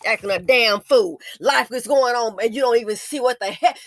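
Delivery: normal talking voice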